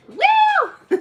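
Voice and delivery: high squealed woo with a laugh; reading a cheer. Retold or imitated. Reading a viewer's cheer aloud, high laughing woo. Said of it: "Says Rainbow".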